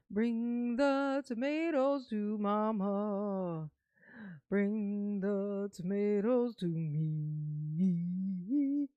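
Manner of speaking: very slow, stretched playful command; slow drawn-out demand. Pretend. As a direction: stretch every word teasingly.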